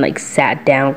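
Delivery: normal talking voice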